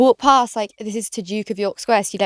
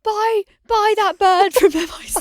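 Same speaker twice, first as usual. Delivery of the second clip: mock drunk lads; 10 semitones higher than usual. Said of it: "they were like".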